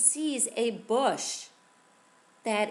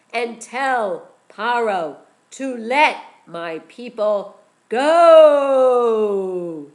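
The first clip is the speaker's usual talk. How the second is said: divine voice; 12 dB louder, slower than usual. A narrator's reading. Hashem commands Moshe.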